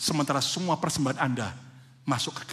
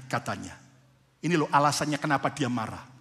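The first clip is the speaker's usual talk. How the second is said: normal talking voice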